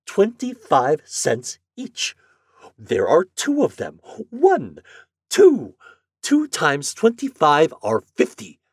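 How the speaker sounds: slow, spelled-out sarcasm; forced calm sarcasm. Acted. Morehouse mocking the agent with forced calm. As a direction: talk down to him slowly. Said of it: "spoke slowly but with intense sarcasm".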